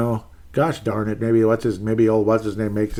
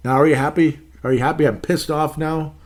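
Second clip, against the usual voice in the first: agitated; 5 semitones higher, 4 dB louder than usual. Real, not acted. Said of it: "now I'm starting to get agitated".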